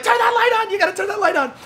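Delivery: high-pitched panicky yelp; panicked plea. Retold or imitated. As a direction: beg in a high, frantic voice. Retold panicked plea to friends.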